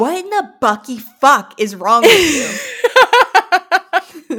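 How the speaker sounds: half-laughing fake yell at a stranger; mock outrage, laughing. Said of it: "You should turn around and be like".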